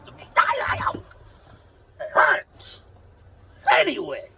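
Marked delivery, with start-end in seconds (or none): none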